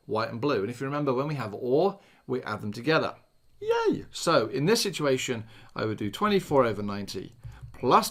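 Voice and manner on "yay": high squeaky yay; excited cheer. Pretend. Mock cheer over adding rule.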